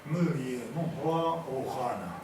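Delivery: measured poetic delivery with drawn-out words; poem recitation. Acted. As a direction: declaim each line slowly, pausing between phrases.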